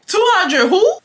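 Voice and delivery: very high, rising question; comically outraged high double take; exaggerated disbelief. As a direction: squeal the question, voice shooting up. Incredulous reaction to money request.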